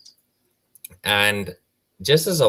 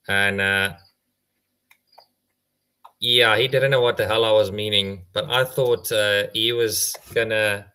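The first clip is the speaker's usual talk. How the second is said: ordinary talk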